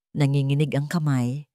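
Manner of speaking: ordinary delivery, nothing marked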